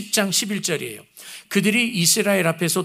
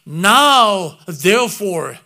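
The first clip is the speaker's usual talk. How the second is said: Now therefore, drawn out very slowly and louder; slow English quote; 7 dB louder, much slower than usual. Shown as a demonstration. Preacher quoting the English Bible, slowly and deliberately. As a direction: savor Now therefore word by word. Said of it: "그러므로가 아니라".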